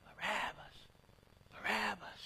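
name heard faintly from outside the cell; faint call. Acted. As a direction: call it as if from far away. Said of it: "he hears his name very faintly".

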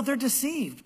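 usual speaking voice

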